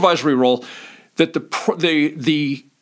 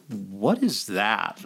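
puzzled disapproval; 9 semitones lower, 8 dB quieter, much slower than usual. Retold or imitated. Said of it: "looked at and said".

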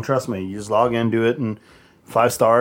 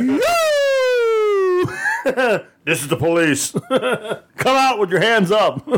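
hammed-up police order, slowed at the end; mock police call; 10 semitones higher, much slower than usual. Joking about scaring teens in the car, as a mock police officer. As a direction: pretend cop shouting through laughs, pitch jumping high at the start. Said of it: "Shoulda went up there".

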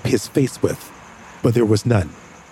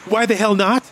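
exasperated; 9 semitones higher than usual. Acted. Arguing with James.

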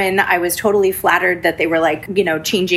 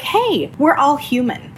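slow, higher, gentle reassurance; reassuring agent; 8 semitones higher, slower than usual. Retold or imitated. Agent comforting the tearful realtor, slow and gentle. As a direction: soothe her like the kind agent did. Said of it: "And he was like".

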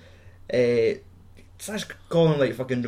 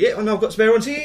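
higher, laughing mock boast; 8 semitones higher, 4 dB louder than usual. Drummer boasting about spare sticks in his socks, laughing. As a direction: brag through a laugh.

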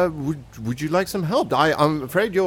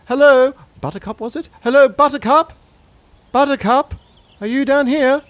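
calling out; 10 semitones higher, 6 dB louder than usual. Pretend. Calling for lost dog in alley.

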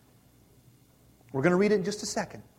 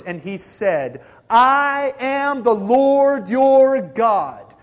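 solemn scripture reading; 7 dB louder than usual. Real, not acted. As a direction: read the Lord's words with weight. Reading the commandments aloud.

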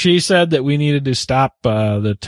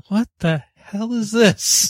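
baffled new listener; 9 semitones higher, slower than usual. Retold or imitated. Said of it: "Just kinda went".

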